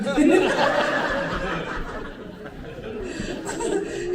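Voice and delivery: long hearty laugh; real laugh at the joke. Real, not acted. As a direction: laugh along with the crowd. Audience joke, speaker laughs.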